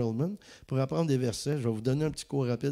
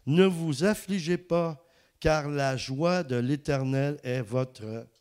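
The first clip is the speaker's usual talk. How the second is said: slow, louder solemn Bible reading; preaching from Nehemiah, slow and raised; scripture reading; 3 semitones higher, 3 dB louder, slower than usual. Acted. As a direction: proclaim the verse slowly to the congregation. Reads scripture aloud.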